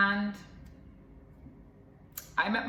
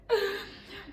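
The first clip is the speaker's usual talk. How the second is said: high-pitched giggle; real high laugh; amused laugh; 14 semitones higher than usual. Real, not acted. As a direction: giggle helplessly before the punchline. Amused before revealing the irony.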